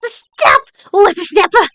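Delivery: put-on cranky old lady shout. Guard voiced shouting after them.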